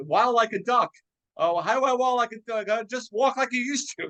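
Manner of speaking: high, quick, playful quote; quoted comic lines. Retold or imitated. [name] quoting the duck waddle joke, high and playful. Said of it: "one of the great lines".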